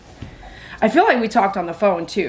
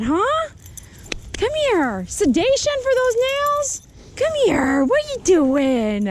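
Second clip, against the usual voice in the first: talking to a dog; 14 semitones higher, slower than usual. Real, not acted. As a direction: squeal playfully at the dog. Groomer greets dog after trim.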